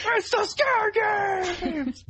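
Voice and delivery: high shrieking cry of a quote; high mock scream; mock shout. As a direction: yell it in a squeaky hammed-up voice. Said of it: "Brock Pearson's appearance".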